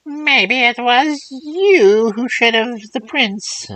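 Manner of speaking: put-on old crone voice. Third old woman speaks.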